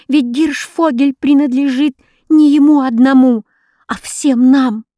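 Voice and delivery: put-on a boy crying out against injustice; indignant outburst. A narrator's reading.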